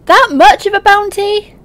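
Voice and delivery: high, loud disbelieving question; stunned by the size of the bounty; shocked. Real, not acted.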